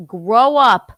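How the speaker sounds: loud, long-drawn "grow up"; pissed-off go-to. Acted. Wife showing her main fight line, angrily exasperated. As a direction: stretch each word out in exasperation. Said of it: "my main go-to is".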